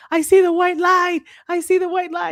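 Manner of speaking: high, hammed-up near-death voice; mock dying man seeing the light. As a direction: act out a dramatic deathbed vision. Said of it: "You were like".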